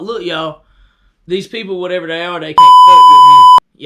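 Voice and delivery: building from firm call to a huge high shout; rally the crowd, then blast the last words; commanding yell to the captives. Retold or imitated. Rallying the sedated dream people.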